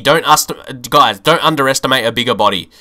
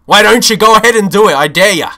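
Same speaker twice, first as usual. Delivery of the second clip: angry taunt; 6 semitones higher, 5 dB louder, faster than usual. Real, not acted. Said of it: "I'm getting angry now".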